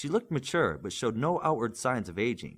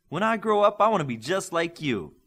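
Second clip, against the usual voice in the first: higher, louder mock admiration; sarcastic; 9 semitones higher, 8 dB louder than usual. A narrator's reading. Cosmos sarcastically teasing Olympian, in loud mock admiration. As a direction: gush it out so nobody believes it. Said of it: "Cosmos said with sarcasm".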